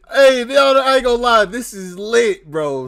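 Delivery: loud, high hyped-up reaction; real excitement. Real, not acted. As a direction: burst out hyped, fresh from laughing. Thrilled after laughing at the list.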